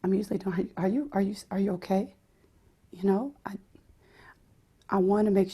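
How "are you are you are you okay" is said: soft, fragile whisper; tender head voice. Shown as a demonstration. Voice teacher demos a fragile head voice, whispered softly. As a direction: hush it gently, like checking on someone hurt. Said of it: "very tender".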